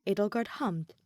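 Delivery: plain reading voice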